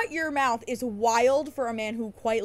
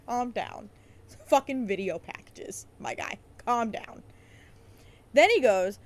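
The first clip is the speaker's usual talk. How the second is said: ordinary delivery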